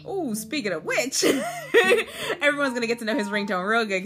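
talking through giggles; laughing. Real, not acted. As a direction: laugh while saying it. Laughing about ringtone.

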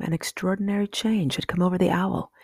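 ordinary delivery, nothing marked

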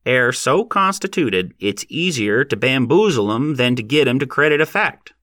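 a hint of a wry, folksy old horseman; nasal voice. A narrator's reading. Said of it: "he said in his nasal voice".

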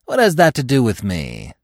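mildly haughty challenge; superior. A narrator's reading. Gobo unmoved by the others' begging, superior. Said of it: "smiling in his superior way".

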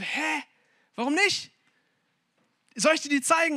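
high-pitched, incredulous questioning; baffled bar owner. Acted. Bar owner to groom's brother who declined strippers, baffled. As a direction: act out a puzzled host pushing his offer.

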